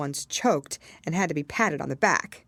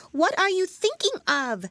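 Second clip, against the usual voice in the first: much higher, louder, stern mouse voice; severe mouse; 5 dB louder than usual. A narrator's reading. Mouse scolds Alice for not listening, stern high voice. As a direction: scold like a prim little mouse. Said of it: "said the mouse to Alice severely".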